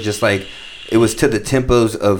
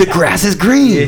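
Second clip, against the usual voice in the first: high, slow, louder rap-style line; mock dumb freestyle; 9 semitones higher, 4 dB louder, slower than usual. Parodying a basic freestyle bar, mock rap.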